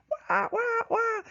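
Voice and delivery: very high squawking gull calls; imitated seagull cries. Teacher mimicking seagulls swarming his wife, high squawks. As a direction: squawk like a flock of gulls.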